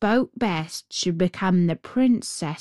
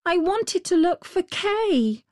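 put-on little girl; 10 semitones higher than usual. A narrator's reading. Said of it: "said the little girl".

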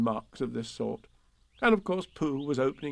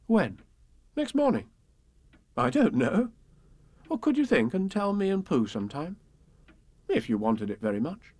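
put-on young boy voice; 6 semitones higher than usual. Christopher Robin voiced as child.

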